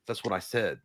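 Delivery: a low, soft comeback in a Walton Goggins manner; Walton Goggins drawl. Retold or imitated. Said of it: "You sound like Walton Goggins just now".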